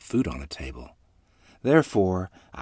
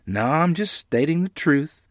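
higher, slower cheerful reply; 9 semitones higher, 6 dB louder than usual. A narrator's reading. Mother answers Grandmother's modesty, cheerful and slow. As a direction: reassure her with a bright smile in the voice. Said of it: "Mother said cheerfully".

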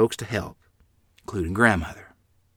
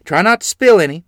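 raised, louder motherly caution; mother's warning; 9 semitones higher, 10 dB louder than usual. A narrator's reading. Mother cautions a child carrying water, calling out. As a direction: call out a caution, lightly voiced mother. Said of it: "Mother said".

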